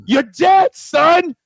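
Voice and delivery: loud, high mock yell; mock shouting dad. Veteran father hearing son joined Marines, mock shouting. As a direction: bark it like an angry army father. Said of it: "they're gonna be like".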